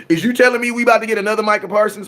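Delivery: can't believe what he's hearing; incredulous. Real, not acted. Ranting at draft fans in fast, high disbelief.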